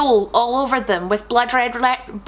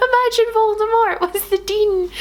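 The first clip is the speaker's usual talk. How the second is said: high, strained through tears of laughter; laughing; 9 semitones higher than usual. Real, not acted. Picturing Voldemort as the Dean, weeping with laughter. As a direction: squeeze it out while still laughing.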